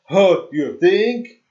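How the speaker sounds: loud, slow sarcastic retort. Pretend. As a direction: drag it out, mocking. Zero's sarcastic reply.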